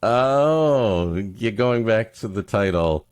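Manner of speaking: ordinary delivery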